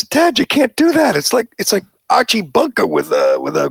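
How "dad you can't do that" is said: put-on much higher, a bit louder, childlike reprimand; mock child scolding. Host voicing Damon's daughter correcting him, piping up. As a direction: play the offended kid scolding her father.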